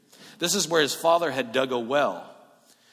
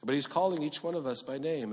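ordinary delivery

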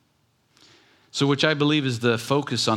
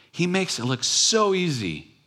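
high, whining mock complaint; self-mocking whine about the painting; whiny complaint; 8 semitones higher than usual. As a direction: whine it like a jealous painter, pitched way up. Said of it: "that's what I was saying".

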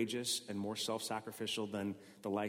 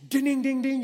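bell imitation; 13 dB louder than usual. Game-show bell.

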